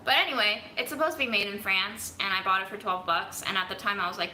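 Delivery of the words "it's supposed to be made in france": ordinary talk, nothing marked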